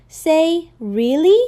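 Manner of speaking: Really lifted high at the end; rising question tune. Shown as a demonstration. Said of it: "with your voice lifting".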